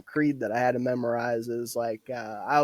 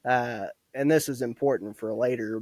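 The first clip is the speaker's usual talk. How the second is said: ordinary talk, nothing marked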